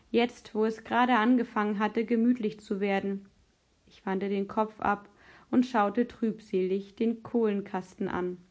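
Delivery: usual speaking voice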